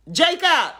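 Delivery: loud, high-pitched call; holler. Real, not acted. Aunt calling her great-nephew mid-broadcast, hollering. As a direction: holler for someone in another room. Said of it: "I had to holler at Jacob".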